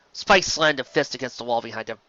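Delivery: ordinary delivery, nothing marked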